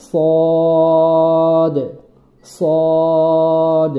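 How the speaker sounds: "সদ" with a heavy, full S and long held O; thick sad. Shown as a demonstration. Teacher showing learners the letter sad, heavy and held. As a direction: say "সদ" thick and drawn out, lips not rounded. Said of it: "একটু মোটা করে বলতে হবে".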